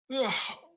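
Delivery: loud high yawning breath; big yawn. Real, not acted. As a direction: let out a long rising yawn. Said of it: "Sorry, yawning".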